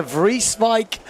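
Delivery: ordinary delivery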